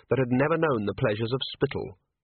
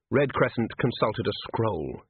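ordinary delivery, nothing marked

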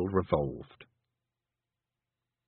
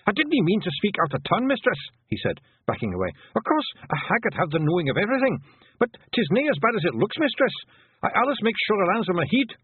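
high, louder panicky pixie voice; scared Hamish; 11 semitones higher, 3 dB louder than usual. A narrator's reading. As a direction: babble apologies in fright. Hamish backing away in terror.

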